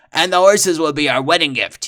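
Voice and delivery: infatuated trapper; lovestruck trapper. Acted. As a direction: propose with melodramatic devotion. Smitten villain proposes.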